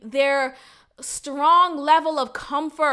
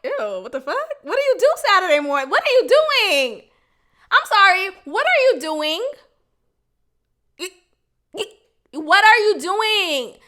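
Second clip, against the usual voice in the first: lightly voiced disgusted friend, rising to loud disbelief; mock disgust; 4 semitones higher, 4 dB louder than usual. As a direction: play the grossed-out friend, half-joking. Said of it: "I should be looking at y'all like".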